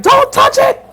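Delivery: sharp, very high, loud warning; urgent warning. Retold or imitated. Witch warning the narrator off the fallen book, high loud. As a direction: cry out high to stop her. Witches warn her in bookstore.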